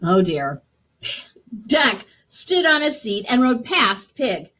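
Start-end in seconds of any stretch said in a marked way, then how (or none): none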